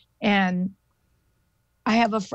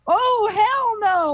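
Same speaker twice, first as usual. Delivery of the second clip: high, drawn-out exclamation; alarmed outburst; 13 semitones higher, 3 dB louder, slower than usual. Retold or imitated. Friend reacting to the shaking shed, high alarmed cry. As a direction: blurt it high and stretched out. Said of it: "like that".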